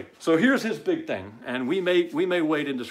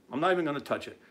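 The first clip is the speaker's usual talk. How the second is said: ordinary talk, nothing marked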